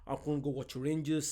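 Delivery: usual speaking voice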